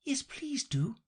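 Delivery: breathy high plea; unvoiced pleading whisper. A narrator's reading. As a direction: beg in a whisper. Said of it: "pleaded Alice".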